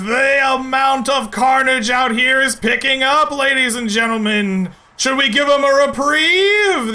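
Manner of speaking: booming arena announcer hype; fighting game announcer. Acted. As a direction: hype up the crowd like a ring announcer. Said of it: "The arena announcer says".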